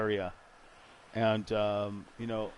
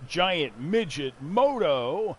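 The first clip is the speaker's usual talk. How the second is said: radio announcer voice; 6 semitones higher, 6 dB louder, slower than usual. Pretend. Mock radio show intro.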